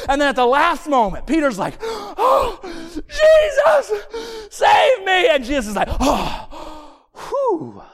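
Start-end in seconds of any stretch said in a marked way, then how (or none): 1.8-5.3 very high, drawn-out mock scream; desperate drowning cry. Peter drowning calls to Jesus. 6.0-7.8 high gasp, then a big whew; exaggerated sigh of relief after a scare; relieved gasp. Jesus acted catching Peter, relieved.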